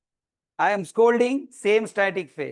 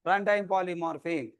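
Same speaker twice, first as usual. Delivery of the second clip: ordinary talk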